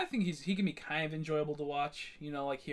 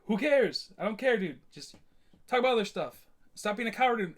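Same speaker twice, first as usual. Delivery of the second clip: raised, louder exasperated retort; exasperated outburst; 7 semitones higher, 9 dB louder than usual. Retold or imitated. Streamer telling off someone who brings up too much, exasperated. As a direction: fire it off, fed up, high and loud.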